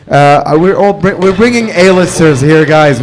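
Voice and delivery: ordinary delivery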